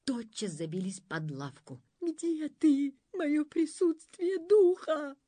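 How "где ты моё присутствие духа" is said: high, slow frightened whine; whimpering. A narrator's reading. Pif-Paf hiding under the bench, whimpering. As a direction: whimper it out higher than your own voice. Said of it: "захныкал Пиф-Паф".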